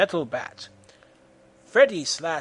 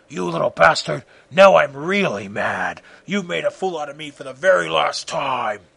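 angry villain; 4 dB louder than usual. Acted. Freddy, furious, chokes Goku.

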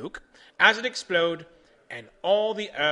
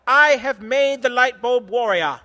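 louder, higher proud boast; triumphant; 7 semitones higher, 10 dB louder than usual. Acted. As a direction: crow about the invention. Edison triumphant.

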